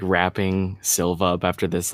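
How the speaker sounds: normal talking voice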